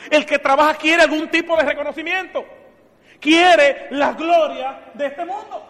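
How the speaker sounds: loud, high preaching at full force; fervent preaching. Acted. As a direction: drive the point home to the congregation.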